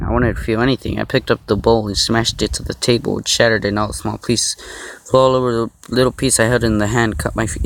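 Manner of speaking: usual speaking voice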